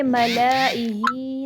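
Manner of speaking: "ملائہی" with a long held middle a; wrongly lengthened alif. Shown as a demonstration. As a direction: overstretch the a inside "ملائہی". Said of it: "ملائہی نہیں پڑھنا ہے".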